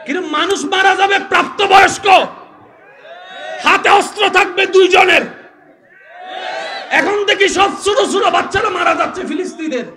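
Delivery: high, shouted indignant sermon; full-force preaching. Real, not acted. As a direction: hurl the argument at the crowd, loud and high. (উঁচু গলায় গর্জে ওঠা ওয়াজ)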